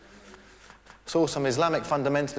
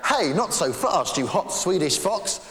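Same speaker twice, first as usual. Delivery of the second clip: a hint of a smug ladies' man, higher and louder; smug lover; 6 semitones higher, 4 dB louder than usual. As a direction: play it cool and boastful.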